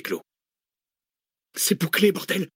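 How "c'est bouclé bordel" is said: high-pitched angry outburst; cursing. A narrator's reading. Researcher swearing at the closed gate, cursing. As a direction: curse in alarm, pitch jumping up. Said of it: "pesta la chercheuse".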